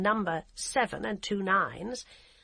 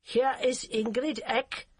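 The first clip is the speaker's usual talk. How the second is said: put-on slow, higher, curt German-accented English; heavy German accent; 5 semitones higher, 3 dB louder, slower than usual. Hamburg policewoman answering the phone in a curt, heavy German accent. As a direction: clip it like a stiff German officer. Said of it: "with an accent so heavy".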